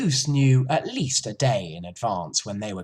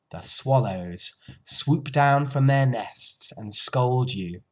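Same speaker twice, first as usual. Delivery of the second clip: ordinary delivery, nothing marked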